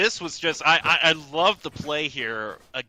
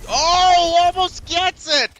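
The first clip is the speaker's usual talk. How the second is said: much higher, loud hype yell; excited shout; 14 semitones higher, 11 dB louder, slower than usual. Real, not acted. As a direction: burst out at the near miss. Near kill on the big play.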